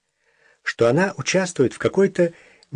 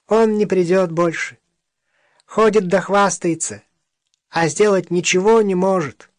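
thin voice; 6 semitones higher than usual. Acted. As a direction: pipe it up high and thin. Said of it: "тонким голосом сказал".

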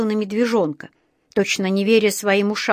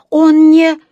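loud, high, broken-off question; alarmed; 8 semitones higher, 11 dB louder than usual. A narrator's reading. Mother fearing for the bear, alarmed cry. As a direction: cry out and stop short in dread. Мать в испуге за медвежонка.

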